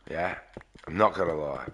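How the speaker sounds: ordinary talk, nothing marked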